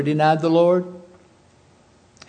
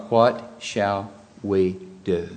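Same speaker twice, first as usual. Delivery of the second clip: slow, lower and hushed cry of remorse; anguished plea; 5 semitones lower, much slower than usual. Retold or imitated. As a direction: ask it as if cut to the heart. Crowd cries out in remorse.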